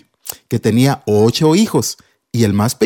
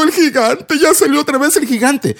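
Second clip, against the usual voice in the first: mildly alarmed, higher voice; a touch of fear in the report; second soldier warning David; worried soldier; 12 semitones higher than usual. Acted. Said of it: "otro soldado diciendo".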